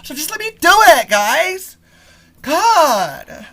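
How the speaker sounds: loud, high, fed-up cry at the listeners; exasperated outburst. Real, not acted. Podcaster begging his audience to let him talk, exasperated yell. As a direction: throw up your hands and yell it out.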